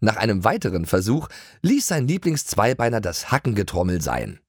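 plain reading voice

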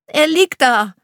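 put-on high, loud insisting boy; insistent child. A narrator's reading. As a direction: press the point like a scared kid. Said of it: "beharrt Hugo".

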